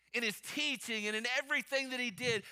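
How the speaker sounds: ordinary talk, nothing marked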